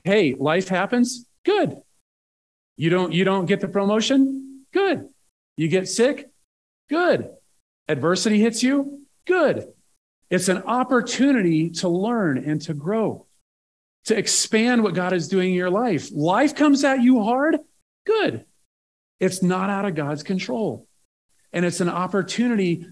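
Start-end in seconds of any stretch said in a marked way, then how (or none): 0.0-9.7 punchy "good". Retold or imitated. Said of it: "it's kinda like". 16.1-18.5 punchy "good". Retold or imitated. Echoing Jocko's good mantra.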